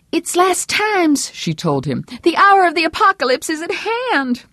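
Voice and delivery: a devout wife rebuking her husband's blasphemy; pious wife voice. A narrator's reading. Vera scolding Herb for blaspheming, prim and high.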